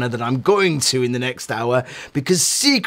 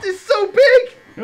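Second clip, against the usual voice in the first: mock wailing; 4 dB louder, slower than usual. Skit, overwhelmed cry.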